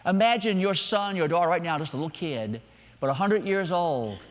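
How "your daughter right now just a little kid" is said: ordinary talk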